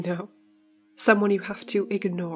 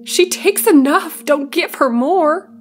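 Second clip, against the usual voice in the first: much higher, louder, slow shriek; frantic scream; 8 semitones higher, 5 dB louder, slower than usual. A narrator's reading. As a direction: shriek it in panic. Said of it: "she screamed".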